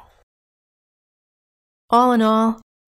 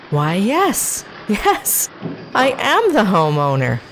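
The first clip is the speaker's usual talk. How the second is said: proud realization; 5 semitones higher, slower than usual. Retold or imitated. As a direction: announce it like royalty. She proudly realizes she owns it.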